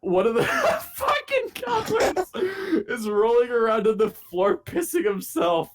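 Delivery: high, breaking into laughter mid-sentence; laughing while telling. Real, not acted. Telling friends about the counselor's hat, real laughter. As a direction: talk through real laughter.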